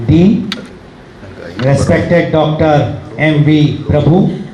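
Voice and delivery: slow, halting read from paper; stiff reading aloud. Real, not acted. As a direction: spell out names off a sheet. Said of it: "I am reading the, uh, writing of a doctor".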